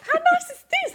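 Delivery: very high, laughing gush; gushing in the library, very high and laughing; delighted gushing. Retold or imitated. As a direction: gush like a thrilled young guest. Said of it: "going, going".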